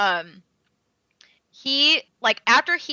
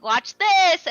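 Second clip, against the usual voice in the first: higher, louder gleeful taunt; taunting villain; 9 semitones higher, 8 dB louder than usual. Retold or imitated. Villain to Itadori before attacking Nobara, taunting and loud. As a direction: show off just before the blow.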